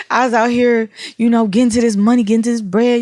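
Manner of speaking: loud mock boasting, quick in the middle; big talk, lightly hammed up; grandiose, boisterous. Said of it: "that's really grandiose and, you know, boisterous".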